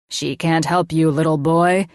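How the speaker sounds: mad scientist. A narrator's reading. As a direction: play villain with mock menace.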